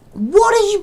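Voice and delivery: high, loud shrieking demand; furious shriek. Real, not acted. At Photoshop not showing the bounds, a high furious shriek. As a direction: scream the question at the program.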